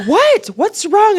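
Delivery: high, loud startled question; retold baffled alarm. Client baffled by her giggling, high alarmed questions. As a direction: shoot the pitch right up in mock alarm.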